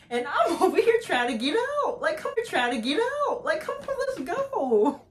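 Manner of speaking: high, loud, frantic hurry-up; urgent exasperated pleading. Retold or imitated. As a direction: plead to get out, voice jumping way up. Retelling her urgent pleading to move.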